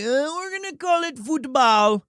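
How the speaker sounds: high-pitched caricature of the English; mock them in a high, put-on voice; hammed-up high English snob. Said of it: "And then they're like".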